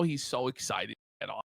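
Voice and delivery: ordinary talk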